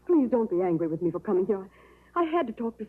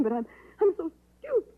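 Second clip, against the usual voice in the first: breaking into tears; 6 semitones higher than usual. Acted. Nurse breaks down over her mistake.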